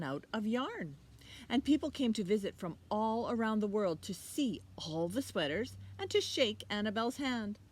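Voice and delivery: put-on lively read-aloud to children; story reading. A narrator's reading. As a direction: read it like a picture book to kids. Teacher reads story aloud.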